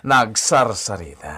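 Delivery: usual speaking voice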